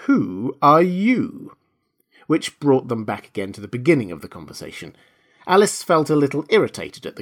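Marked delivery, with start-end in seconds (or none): 0.1-1.6 very slow, high, swooping disdain; contemptuous. A narrator's reading. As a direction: stretch every word scornfully. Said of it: "said the caterpillar contemptuously".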